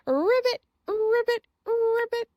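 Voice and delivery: high, playful ribbit; silly frog. Retold or imitated. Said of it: "The racha is so silly".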